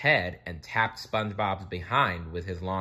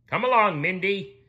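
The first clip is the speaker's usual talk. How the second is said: high loud summons; commanding king; 12 semitones higher, 6 dB louder than usual. A narrator's reading. Neptune summoning Mindy, loud command. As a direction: order her to follow. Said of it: "commanded King Neptune".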